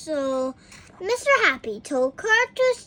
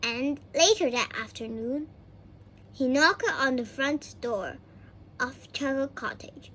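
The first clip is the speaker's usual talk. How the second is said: ordinary delivery, nothing marked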